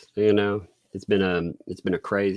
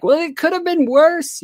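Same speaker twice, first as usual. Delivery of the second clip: much higher, light put-on doctor voice; high, cheerful mock doctor quip; imitating the Indian doctor; 6 dB louder than usual. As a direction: quote the doctor with his accent, hammed up. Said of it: "the doctor was like".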